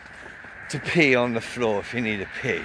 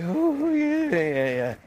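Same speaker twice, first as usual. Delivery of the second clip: mock meek tough guy; 13 semitones higher, slower than usual. Mocking tough guys backing down.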